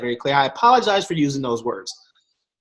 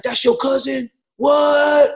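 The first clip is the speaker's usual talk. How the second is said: high, rising into a loud what; mock people who can't believe it; disbelief; 9 semitones higher, 5 dB louder, much slower than usual. Strangers hearing about his cousin, loud disbelief.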